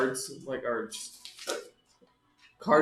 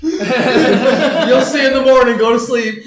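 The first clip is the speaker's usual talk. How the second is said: loud laughing; 9 semitones higher, 15 dB louder, much slower than usual. Acted. Loud laughing role line.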